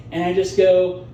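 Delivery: ordinary talk, nothing marked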